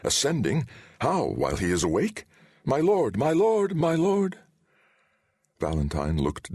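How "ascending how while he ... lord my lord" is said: frantic cries of my lord; panicked calling. A narrator's reading. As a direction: call to the fallen lord in panic.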